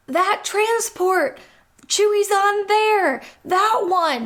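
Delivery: high, slow hammed-up pointing shout; exaggerated urgency. Finn points out the transport, high and overplayed. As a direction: overplay it like a comedy beat. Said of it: "Finn exaggeratedly points to it".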